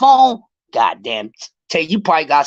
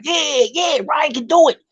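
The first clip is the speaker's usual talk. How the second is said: mocking hype fans; 8 semitones higher than usual. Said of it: "And everybody like".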